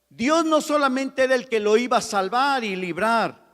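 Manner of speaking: loud, high preaching at full force; impassioned sermon voice; fervent preaching. Acted. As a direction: preach it with rising passion. Preaching resumes.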